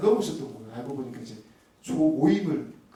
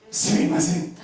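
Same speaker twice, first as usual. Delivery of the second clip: mock apology; 5 semitones higher, 6 dB louder, slower than usual. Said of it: "사과했습니다".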